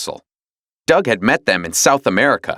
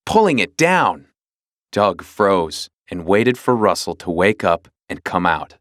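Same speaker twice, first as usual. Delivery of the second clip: plain reading voice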